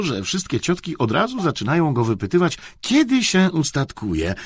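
ordinary delivery, nothing marked

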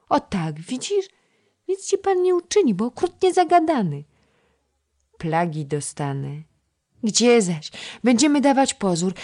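put-on a chattering little girl coaxing the boy to play; little girl Krysia. Narrator voices little Krysia. (wysoki głosik małej dziewczynki)

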